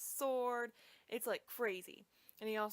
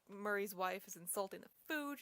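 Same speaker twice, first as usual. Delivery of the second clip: normal talking voice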